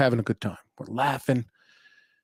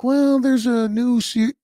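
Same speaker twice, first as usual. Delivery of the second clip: a higher, slower put-on voice, like his wife; quoting his wife; 9 semitones higher, 3 dB louder, slower than usual. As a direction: lightly voice the wife's eager request. Retelling his wife's words.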